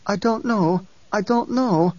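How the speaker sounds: high, panicked denial; frightened. A narrator's reading. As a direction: cower and plead ignorance. Sabiha cowering, frightened.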